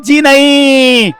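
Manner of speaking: high, loud, drawn-out call; excited commentary. Real, not acted. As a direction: stretch it out with excitement. Fielder misses, drawn-out call.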